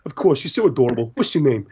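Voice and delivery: put-on high, sing-song talk to a kid; doting adult to child. Dan cooing over a little fan, sing-song. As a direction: coo at a little boy.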